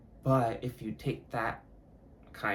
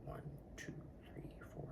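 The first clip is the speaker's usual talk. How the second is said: very soft whispered count; barely audible tallying, no voice; counting under breath; 16 dB quieter than usual. Real, not acted. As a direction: whisper the numbers to yourself. Counting rosary decades to themselves.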